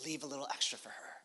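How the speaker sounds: hushed, quick aside in a retold story; soft, hurried confidential murmur, still voiced; mock whisper. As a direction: lightly imitate a discreet whisper, softly and quickly. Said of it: "And whispers to his workers".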